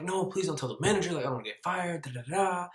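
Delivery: high, soft begging; pleading. Retold or imitated. Son begging mom about manager, high pleading.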